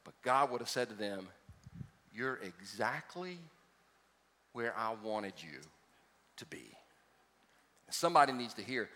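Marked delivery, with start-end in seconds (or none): 2.1-6.7 tender voice of God. Acted. God's gentle reply to Abraham.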